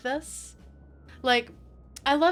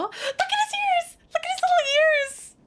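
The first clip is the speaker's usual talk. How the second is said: cute-overload squeal; 5 dB louder than usual. Real, not acted. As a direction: squeal it like you've spotted something adorable. Gushing over cute creature's ears.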